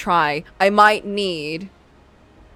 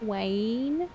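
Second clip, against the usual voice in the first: put-on Essex accent; 3 semitones higher, 8 dB quieter, much slower than usual. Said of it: "I cannot hear twain in an Essex accent".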